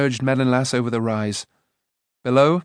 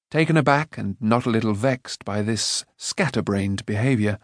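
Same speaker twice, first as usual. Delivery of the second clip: usual speaking voice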